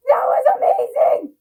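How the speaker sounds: high, loud, almost crying; overwhelmed with joy; near-tearful shout. Real, not acted. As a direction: fan crying out after the trailer ends. Fan right after trailer ends.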